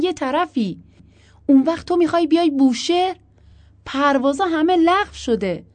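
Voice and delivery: high, loud exasperation; heated disbelief and arguing; incredulous retort. A narrator's reading. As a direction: fire back loudly, pitched right up. Husband incredulous, arguing back.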